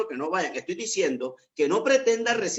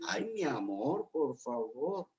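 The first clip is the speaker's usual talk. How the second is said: soft, slow, drawn-out plea to a spouse; lowered gentle tone; 9 dB quieter, much slower than usual. Acted. Spouse answering an argument with a soft answer, gentle lowered tone. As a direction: drop the volume right down and plead slowly. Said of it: "cuando usted baja el tono".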